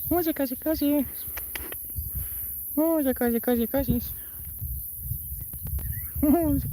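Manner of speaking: very high cooing pet talk; sing-song baby voice for the cat. Acted. Streamer baby-talking her cat, very high and cooing.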